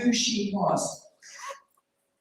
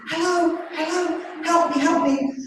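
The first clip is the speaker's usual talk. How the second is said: mild mock shout; 6 semitones higher than usual. Homeless lady calling to passers-by, a higher mock shout. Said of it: "she was shouting".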